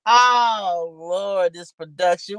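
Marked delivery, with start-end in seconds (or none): none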